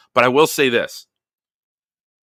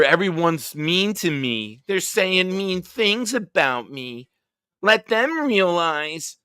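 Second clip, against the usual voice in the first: put-on higher, slow whiny baby voice; mock whiny crybaby; 8 semitones higher, slower than usual. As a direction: whine it like a pouting overgrown kid. Mocking the player's complaints.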